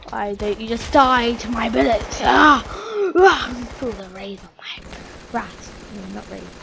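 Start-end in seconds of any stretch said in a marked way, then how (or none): 0.1-2.2 loud gamer frustration; raised voice, exasperated; frustrated yell. Real, not acted. Yelling at enemies in game. 2.2-3.5 two loud, high yells; loud battle cries; frustrated scream. Real, not acted. Screams while shooting at enemies.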